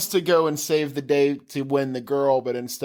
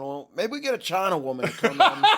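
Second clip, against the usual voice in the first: a laughing, put-on Aussie Mel Gibson; half-failed Australian accent, laughing through it; mock Australian accent; 3 semitones higher than usual. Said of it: "I'm sorry, he's Australian".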